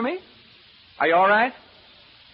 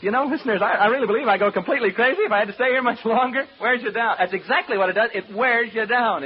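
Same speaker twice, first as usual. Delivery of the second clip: rising nerves; 7 semitones higher, 6 dB louder than usual. Acted. Rising panic to listeners.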